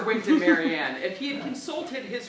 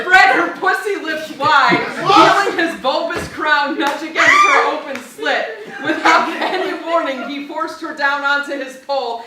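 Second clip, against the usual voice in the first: laughing; 5 semitones higher, 10 dB louder than usual. Real, not acted. Cracking up reading the script.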